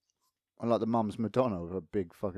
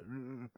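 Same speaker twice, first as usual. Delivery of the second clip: hungover groan; 18 dB quieter than usual. Retold or imitated. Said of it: "coming out in the morning like".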